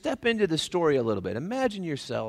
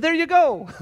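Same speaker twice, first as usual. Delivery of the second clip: laughing; 7 semitones higher, 6 dB louder than usual. Real, not acted. Laughing retort to congregant.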